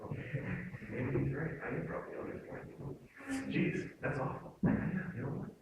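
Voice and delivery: very soft, deflated self-talk; dejected. Acted. Speaker acting out someone crushed by an insult, dejected. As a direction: shrink into a hushed, defeated murmur. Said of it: "my shoulders go down, my chin goes down".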